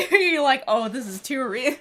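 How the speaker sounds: loud laughing tease. Real, not acted. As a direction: laugh through the words. Teasing partner, laughing hard.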